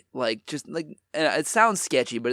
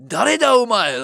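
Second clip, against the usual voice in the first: loud, raised-pitch mock Japanese outburst; imitated angry yell; 8 semitones higher, 7 dB louder than usual. Furious man confronts the storyteller in Japanese, imitated angry yell. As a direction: bark it like an enraged stranger, imitating. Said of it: "like, really angry shit".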